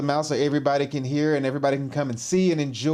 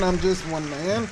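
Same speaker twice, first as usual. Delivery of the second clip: higher, hammed-up humble plea; mock humble; 5 semitones higher than usual. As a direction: play the modest star for laughs.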